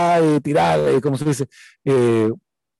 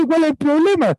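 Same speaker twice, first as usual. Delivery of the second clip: respuesta despreocupada; 12 semitones higher than usual. Retold or imitated. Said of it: "le digo yo".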